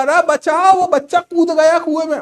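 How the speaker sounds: high, loud alarm shout; retold frantic yell; panicked cry for help. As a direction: scream for help as the child jumps in. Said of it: "over acting मत कर".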